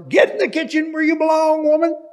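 imagined rebuke to Mary, shouted harshly; mock harsh shout. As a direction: yell it like a domineering master. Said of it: "I'm not gonna tell her".

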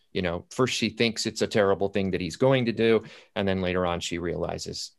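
ordinary talk, nothing marked

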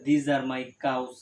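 very slow, word by word; teacher modelling a plural sentence slowly; slow model sentence. Shown as a demonstration. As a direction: spell out "These are my books" slowly. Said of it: "তাহলে".

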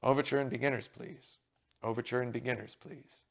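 loud backstage call, each "please" dropping away. A narrator's reading. Callboy summoning actors to the stage, loud call. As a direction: call it down the passage. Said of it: "A voice in the passage behind them called".

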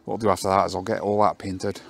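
normal talking voice

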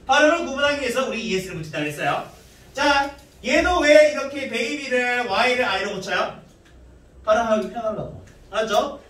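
ordinary talk, nothing marked

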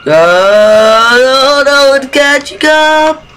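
fleeing in terror; panicked. Real, not acted. Chased again in horror game.

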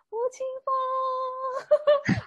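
high, drawn-out star-struck cry of the idol's name; fan scream. Pretend. Fan screaming her idol's name at the concert, drawn-out cry. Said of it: "I'll be exactly like [name]".

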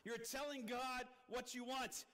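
ordinary talk

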